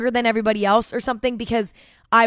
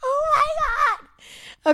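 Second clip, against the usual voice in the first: slow, rising into a high laughing shriek; cringing laughing outburst; mortified laugh; 5 dB louder, much slower than usual. Real, not acted. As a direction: groan in mortified embarrassment, laughing. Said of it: "so fucking mortified".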